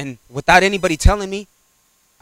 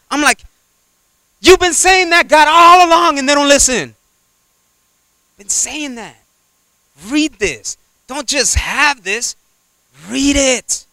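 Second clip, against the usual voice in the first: high preaching cry; 10 semitones higher than usual. Acted. Preacher exhorting congregation, high fervent preaching. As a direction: preach it out with fire.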